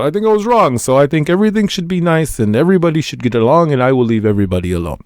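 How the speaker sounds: high, fast, hammed-up fake Russian accent; bad Putin accent. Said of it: "And I did a terrible accent".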